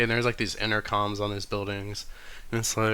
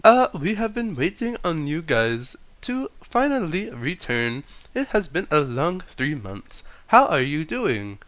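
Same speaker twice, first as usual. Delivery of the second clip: high, loud, stiff robot greeter; 7 semitones higher, 6 dB louder than usual. Acted. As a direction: greet them like a cheery robot. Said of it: "and it's like".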